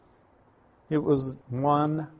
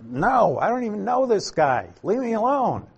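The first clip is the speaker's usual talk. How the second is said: higher, rising into an exasperated snap; cursing denial; 8 semitones higher than usual. Retold or imitated. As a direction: push him away, voice climbing higher and faster. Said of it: "he's cursing and swearing".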